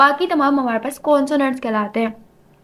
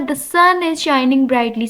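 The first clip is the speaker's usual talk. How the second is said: slow dictation; much slower than usual. Shown as a demonstration. Reading example sentence slowly.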